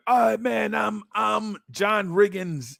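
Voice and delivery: high, slow mock shout of a boy claiming a hero; kid shouting a player name. Neighborhood kids picking players, high slow shout. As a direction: shout it out like a kid calling dibs. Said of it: "People were like".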